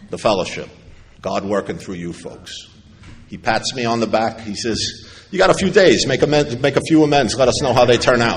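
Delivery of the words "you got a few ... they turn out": higher, louder sponsor voice, giving orders; mock sponsor orders. Old-timer to newcomer at the meeting, higher and louder. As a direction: play the pushy old-timer handing out homework.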